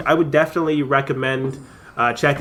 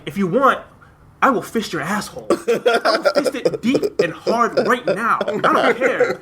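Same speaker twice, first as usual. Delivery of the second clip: higher, slow, laughing boast; mock meathead; 7 semitones higher than usual. Retelling Cena's movie line, laughing mock macho boast. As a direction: ham up the macho offer through laughter. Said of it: "He was like".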